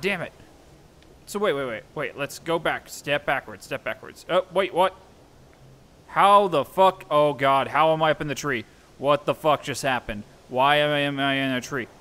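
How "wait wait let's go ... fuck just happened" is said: ordinary delivery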